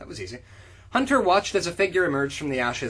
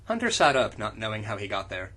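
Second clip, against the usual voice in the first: ordinary talk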